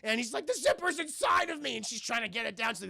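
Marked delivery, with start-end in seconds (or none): 0.5-1.8 high, loud mock wail; hammed-up cry of a hurting kid; panicked wail. Billy panics about zipper.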